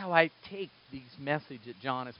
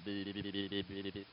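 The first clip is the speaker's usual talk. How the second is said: soft, dazed nonsense sounds; mock confused babble; 5 semitones lower, 11 dB quieter than usual. Disciples after the foot washing, soft dazed babble. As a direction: babble like you understood nothing.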